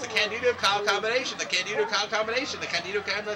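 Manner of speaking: rapid tongue twister. Shown as a demonstration. Announcer trying the tag team's name five times fast, rapid-fire. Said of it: "Say that five times fast".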